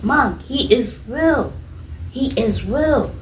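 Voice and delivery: high and slow, he is real; slow, high, stunned insistence; high, slow awestruck plea; awed insistence. Retold or imitated. Insisting to mom.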